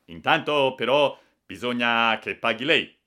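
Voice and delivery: loud, insistent official; forceful official demand; firm demand. A narrator's reading. Official demands payment from the passenger.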